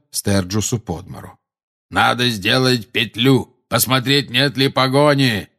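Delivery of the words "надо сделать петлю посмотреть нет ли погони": протяжный оклик, повышенный голос; крикни приказ всему отряду, медленно растягивая слова; командный крик. A narrator's reading. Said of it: "крикнул Грюм". (протяжно кричит)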